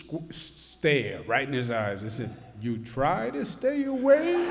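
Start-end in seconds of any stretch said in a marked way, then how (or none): 2.6-4.5 higher, incredulous echo; teasing incredulous repeat; mock disbelief. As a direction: throw his words back at him in disbelief. Governor retorts, disbelieving.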